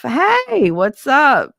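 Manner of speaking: high, loud squeal of hello; sudden bright hey; excited greeting. Real, not acted. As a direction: greet a friend with delight.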